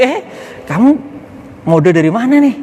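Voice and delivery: high acted teasing question; bullying jeer. Preacher acting out teasing a mismatched outfit, high mocking question. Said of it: "nggak usah dibully".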